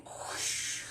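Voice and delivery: breathy whoosh of wind; whooshing rain. Acted. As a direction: blow out a rushing rain sound. Rain came, whoosh sound.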